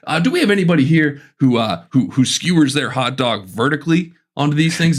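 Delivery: a hint of a comic polling the audience; campground stand-up comic. Camp comic asks his audience about hot dogs, lightly hammed-up. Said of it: "He's like".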